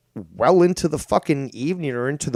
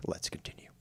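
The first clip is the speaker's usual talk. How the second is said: breathy whispered aside, no voice at all; 16 dB quieter than usual. Shown as a demonstration. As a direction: drop to a hushed whisper. Repeats closing line whispered.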